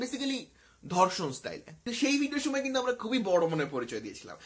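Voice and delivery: ordinary talk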